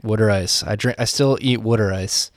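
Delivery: water said as "wooder" in water ice; short "wood" vowel in water; Philadelphia pronunciation. Shown as a demonstration. Host owning up to his Philly word, water pronounced "wooder". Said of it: "I said water for".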